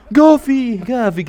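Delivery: name yelled high and loud; excited shout. Real, not acted. Fan hailing Gavi mid-match, loud excited shout. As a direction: holler the player's name. Cheering Gavi's play.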